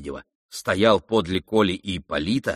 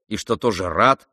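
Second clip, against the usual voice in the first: ordinary delivery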